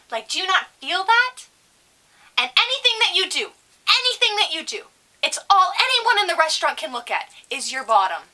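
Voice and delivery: high, affronted lecture in a put-on customer voice; mock a fussy older woman telling someone off; scolding older customer. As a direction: imitate a disapproving grandma, pitch climbing. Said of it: "And she goes".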